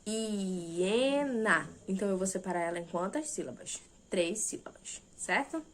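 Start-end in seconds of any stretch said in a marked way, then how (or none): 0.1-1.7 "Hiena" drawn out, each syllable held separately; split into syllables. Shown as a demonstration. Teacher saying the little word to divide it, "Hiena" syllable by syllable. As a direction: stretch "Hiena" slowly into three parts. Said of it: "eu consigo ter essa divisão".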